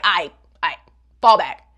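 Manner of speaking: low, loud push-off; hammed-up shove him off with words; mock fall back. [name] telling Ryusei to back off, low loud mock snap. [name] pushing boyfriend away.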